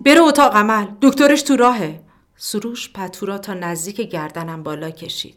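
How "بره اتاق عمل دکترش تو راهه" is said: much higher and louder call from afar; distant woman calling. Acted. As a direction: call it from down the corridor. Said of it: "صدای زنانهٔ دیگری از دورتر گفت". (صدای زنانه از دور، بلند و زیر)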